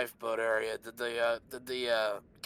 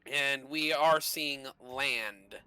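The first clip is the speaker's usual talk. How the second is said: ordinary talk, nothing marked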